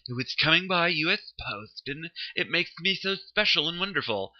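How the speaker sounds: lightly posh, self-important; affected voice. Shown as a demonstration. Showing the voice he's accused of, mildly snooty. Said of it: "some kind of affected voice".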